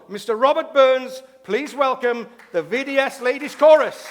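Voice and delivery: loud, high, projected show introduction; stage announcer. Real, not acted. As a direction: announce the act to the whole hall with big sustained emphasis.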